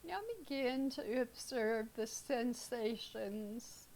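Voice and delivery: calm, unhurried meditation guide with long pauses; guided meditation. Real, not acted. Teacher leading students through breath awareness, guided meditation. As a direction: ease them into stillness, leaving space between phrases.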